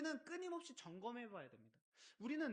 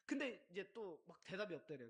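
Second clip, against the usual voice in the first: normal talking voice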